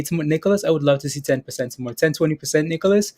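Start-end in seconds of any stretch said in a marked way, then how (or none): none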